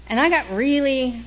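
ordinary talk

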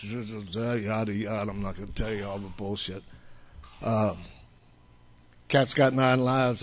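ordinary talk

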